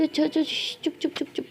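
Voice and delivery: soft, half-whispered shushing; quick whispery comforting; soothing hush. Acted. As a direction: hush someone who is crying. Hushes crying friend.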